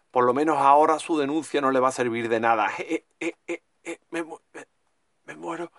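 faint, fading dying voice; dying words. Acted. Character dying after the attack.

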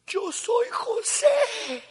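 very slow, high, tearful cry. Retold or imitated. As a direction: sob the words out, breaking. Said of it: "se puso a llorar a gritos".

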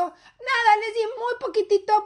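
high-pitched innocent grandmother's excuse; feigned innocence. Acted. Grandma to daughter about the ice cream, feigned innocence.

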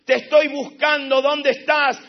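loud, pleading call to heaven; calling to God. Retold or imitated. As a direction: shout up to heaven searching. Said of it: "él dice".

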